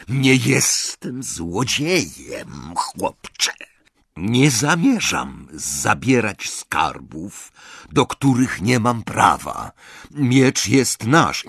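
slow, cold, offended goblin voice. Acted. Goblin, insulted by Ron, coldly refuses.

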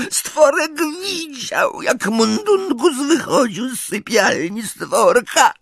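a wronged little elf pleading his case; tearful house-elf. A narrator's reading. Elf's tearful account.

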